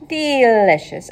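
slow, relishing the word; savouring. Acted. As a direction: taste the word slowly.

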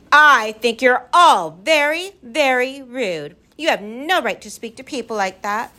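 high, indignant, slowed shout. A narrator's reading. Lindy telling off the rude creatures, shouting. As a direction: shout it as an outraged little girl. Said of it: "she shouted".

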